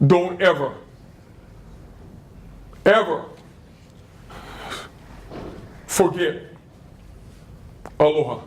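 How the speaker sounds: long pauses between each word, voice dropping; solemn pauses. Retold or imitated. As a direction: lay each word down slowly with weight, "ever" held. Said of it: "She turns to me".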